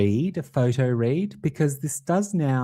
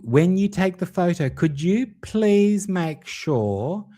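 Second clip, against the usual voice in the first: put-on fussy instruction, pitched up; mock demanding request; 7 semitones higher than usual. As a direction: stretch out the polite demand in mimicry. Voicing woman instructing her photographer.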